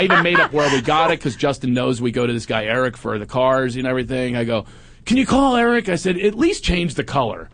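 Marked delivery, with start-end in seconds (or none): 5.1-7.5 1 mock complaint. Fake complaint to wife.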